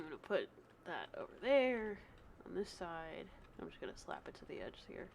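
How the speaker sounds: whispered aside to self; under breath. Real, not acted. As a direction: mutter it to yourself. Muttering while arranging screen.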